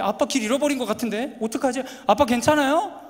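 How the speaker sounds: mock worried kids. Children in the back seat fretting to their dad, mock worried. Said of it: "근데".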